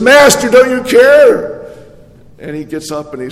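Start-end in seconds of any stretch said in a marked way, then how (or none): 0.0-1.7 panicked plea. Acted. Frightened disciples cry out.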